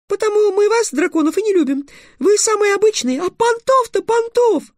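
very high squeaky tirade; raging fairy. A narrator's reading. Fairy telling off the dragons, raging. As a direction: scold furiously in a tiny piping voice. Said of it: "продолжала бушевать фея".

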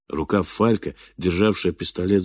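ordinary delivery

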